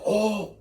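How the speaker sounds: a high, hammed-up "oh" of pain; mock pained cry. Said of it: "It's like".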